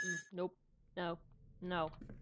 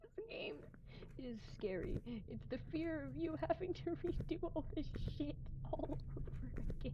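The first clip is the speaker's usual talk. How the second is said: giggling whisper; 8 semitones higher, 3 dB quieter than usual. Real, not acted. Laughing at his own fear.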